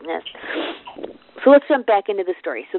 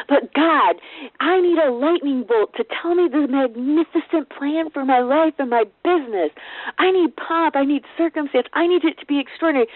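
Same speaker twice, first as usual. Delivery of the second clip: mock prideful demand; 6 semitones higher than usual. Mocking our prideful demands to God.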